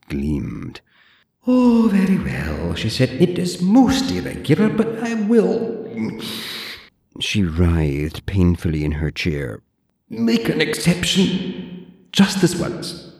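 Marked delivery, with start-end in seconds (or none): 1.5-13.1 put-on high, prim old librarian voice; haughty zombie librarian, clipped and proper; librarian Deirdre. Deirdre lectures the borrower in a high prim voice.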